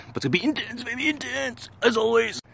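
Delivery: high, pumped-up hype voice; hyped up. Acted. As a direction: sing it out, pumped. Hyping up the workout.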